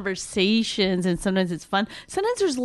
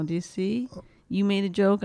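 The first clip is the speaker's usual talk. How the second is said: usual speaking voice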